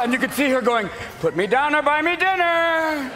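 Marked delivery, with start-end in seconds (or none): 1.2-3.1 high, strained mock protest; hammed-up high complaint; indignant female skater. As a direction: call it out like someone held aloft. Acts lifted female skater.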